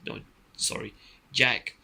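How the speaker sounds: ordinary delivery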